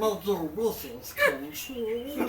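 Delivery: creepy slow voice. Acted. GM in a creepy character voice.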